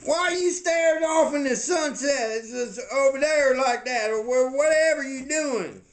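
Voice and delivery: high, exasperated questioning voice; agitated questioning character. Acted. Castmate asking Cookie why he stares at sunset, exasperated.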